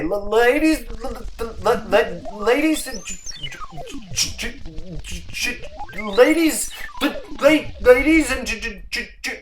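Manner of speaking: excited, high stammer on ladies and gentlemen; stuttering announcer. A narrator's reading. Announcer stammering through the news bulletin, high and excited. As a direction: stammer wildly, unable to get the words out. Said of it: "had a serious speech impediment".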